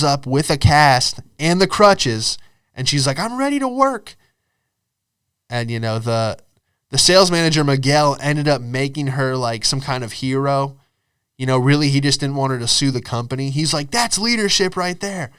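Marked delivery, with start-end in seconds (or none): 3.2-4.2 high, put-on eager voice; eager injured employee. Helen on crutches reporting for work, high eager voice. 13.9-15.3 higher-pitched mock praise; praising sales manager. Manager holding Helen up to staff, mock admiring.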